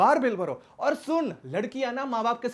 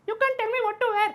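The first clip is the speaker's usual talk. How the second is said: high, drawn-out mimicry of an indignant girlfriend; put-on high-pitched feminist outrage; mock outraged feminist; 13 semitones higher, much slower than usual. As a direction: imitate her protest in a much higher voice, stretching it out. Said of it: "अचानक से feminist type हो जाएगी".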